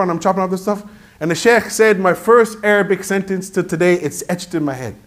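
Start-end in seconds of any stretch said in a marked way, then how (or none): none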